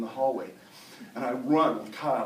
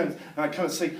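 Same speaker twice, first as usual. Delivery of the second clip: ordinary delivery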